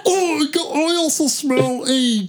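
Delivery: dog voice. Pretend. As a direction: voice a sniffing dog, way up high. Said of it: "Do another dog voice".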